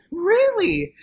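high, loud disbelieving squeak; a stunned little yelp of a question; mock surprise. Imitating Nicole hearing the ad went viral, in mock surprise. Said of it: "And she's like".

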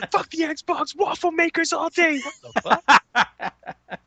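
high squeaky giddy ranting; mock fanboy voice. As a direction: squeal like a console warrior.

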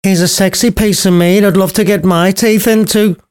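high, cheeky blurting nurse; bawdy nurse. Acted. Nurse blurting about Paris, high cheeky voice. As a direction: blurt it like a saucy old nurse. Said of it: "blurted out the nurse".

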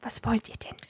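whispering. Acted. Librarian whispers in library.

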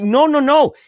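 high loud desperate no; panicked shout. Retold or imitated. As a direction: yell it out in panic. Panicked refusal, retold.

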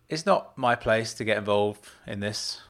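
usual speaking voice

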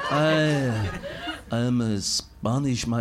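low, halting fake Spanish slipping into French; Spanish turning French. Shown as a demonstration. Retrying the Spanish man, accent drifting into French. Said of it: "It's French".

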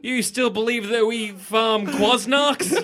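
mock yell. Teacher insulting Clark over his outdated knowledge, mock yelling. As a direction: yell at him like a furious teacher. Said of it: "She has a look".

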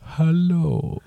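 lightly voiced slow vampire; a hint of a spooky vampire greeting. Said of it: "Do your vampire voice".

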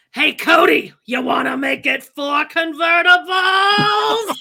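high, slow, shouted mock taunt; loud teasing shout. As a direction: yell it out teasing, stretched. Quoting Reba teasing loudly.

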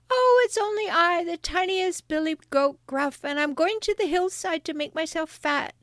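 high-pitched tiny billy goat; small goat voice. Acted. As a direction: squeak innocently like the littlest goat. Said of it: "with such a small voice".

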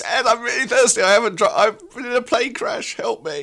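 high, pleading crash survivor begging for water; desperate survivor. Acted. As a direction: plead for help in a high, strained voice.

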